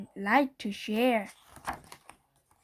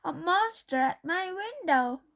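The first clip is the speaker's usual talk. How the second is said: 1 frightened shout; 7 semitones higher than usual. A narrator's reading. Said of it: "shouted Baby".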